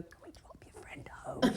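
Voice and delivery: whispered, slow; whispered aside. Retold or imitated. Friend asking to drop her home, whispered. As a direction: whisper it behind her back.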